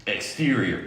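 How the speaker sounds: ordinary talk, nothing marked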